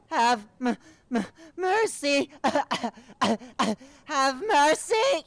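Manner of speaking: very high, loud crying wail; wailing creature. Acted. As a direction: wail for mercy. Creature wails for mercy.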